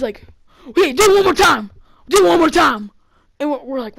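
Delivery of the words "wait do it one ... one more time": loud, high angry dare; pissed off. Retold or imitated. Scare actor daring the kid, angry shout. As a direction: shout it as a threat, acting him out. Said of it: "like, pissed. Like, he's about to kill someone".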